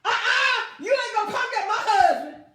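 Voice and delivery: high, loud playful shout at David; hammed-up protective yell; mock shout. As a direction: shout it like a wife guarding her husband. Abigail voiced refusing David.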